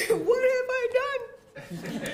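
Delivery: high, loud, drawn-out panicked wail; comic retold panic, close to laughing; mock panic. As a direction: cry it out in hammed-up panic. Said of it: "woke up that morning in sheer panic".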